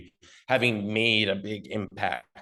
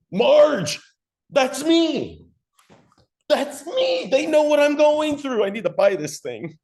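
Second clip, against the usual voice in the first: high, loud, thrilled outburst; hammed-up excited customer; excited recognition; 7 dB louder than usual. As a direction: cry out to your spouse in amazement. Acted customer recognising self in ad.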